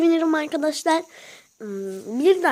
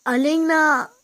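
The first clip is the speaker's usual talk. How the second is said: calling out; 3 semitones higher, 6 dB louder, slower than usual. Real, not acted. Calling friend to answer.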